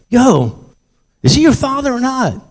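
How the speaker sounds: high-pitched challenging call-out; acted-out urgent challenge; confronting bystander. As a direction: call out a stranger, voice jumping high.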